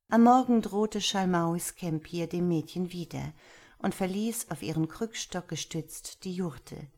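plain reading voice

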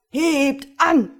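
loud, high-pitched work cry; sailors' shout. Acted. Sailors shouting as they haul crates up, loud shout. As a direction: shout it out like dockhands heaving. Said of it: "schrien sie jedes Mal".

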